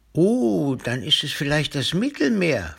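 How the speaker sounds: high, loud "Oh" easing into an excited guess; playful amazement. A narrator's reading. Papa playing along with Timmy's sea story, amazed. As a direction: sound hugely impressed, pitch swooping up.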